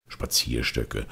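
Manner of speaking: ordinary delivery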